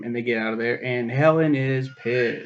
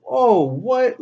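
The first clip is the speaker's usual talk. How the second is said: mock surprise; 11 semitones higher, 8 dB louder than usual.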